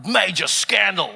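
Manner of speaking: slow, booming mock-grave headline read; TV news announcer. Comedian mimicking Australian news on a major scandal, slow and portentous. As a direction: stretch each headline out with dramatic weight. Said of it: "I saw on the news ... they were like".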